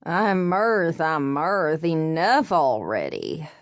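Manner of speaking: pleading in a thick Southern drawl; a slow thick Southern drawl, begging. A narrator's reading. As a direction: beg for mercy in a Southern drawl. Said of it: "begged in a thick southern drawl".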